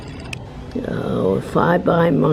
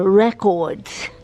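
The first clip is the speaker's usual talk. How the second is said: "records" the British way, with a full second syllable, rec-ords; mock British "records"; British accent. Shown as a demonstration. Vlogger showing how Britain says records, British accent. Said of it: "as they say in Britain".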